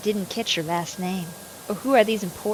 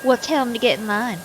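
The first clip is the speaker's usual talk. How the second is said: sassy Southern lady; 4 semitones higher, 5 dB louder than usual. Acted. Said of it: "I'm gonna be a sassy Southern lady".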